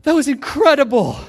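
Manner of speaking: high, loud gush of amazement; excited girl. Retold or imitated. Canadian girl bursting into class, loud and amazed. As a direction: burst in thrilled. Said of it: "she's like".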